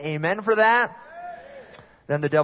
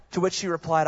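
ordinary talk, nothing marked

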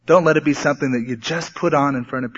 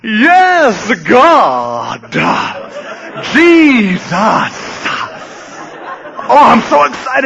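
hammed-up preacher shout, pitched way up; fake pulpit zeal; 8 semitones higher, much slower than usual. Pastor friend switching it on in the pulpit, fake fervent excitement. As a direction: blast it out in mock fervency, stretching the holy names. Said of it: "he'd put on this fake zeal, this fake fervency".

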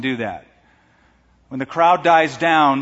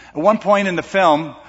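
ordinary talk

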